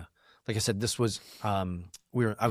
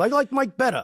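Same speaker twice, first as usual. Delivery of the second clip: high, teasing fan voice; mock fan voice; 12 semitones higher, 4 dB louder than usual. Another diner telling the host he prefers Mike, high and teasing. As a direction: quip it like a picky listener.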